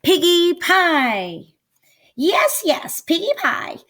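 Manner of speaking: high, loud witch gloating over her dinner; greedy witch voice. Acted. Witch drooling over the thought of piggy pie, high and greedy. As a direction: squeal it like a hungry witch. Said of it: "Gritch said, smacking her lips".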